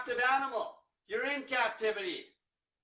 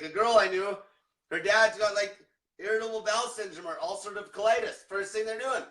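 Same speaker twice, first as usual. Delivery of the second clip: normal talking voice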